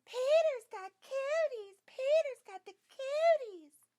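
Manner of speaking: loud, high, slow singsong taunt; taunting yell. A narrator's reading. Sheila yells at Peter after touching him, a loud taunting chant. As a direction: shout it to tease the whole playground. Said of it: "she yells".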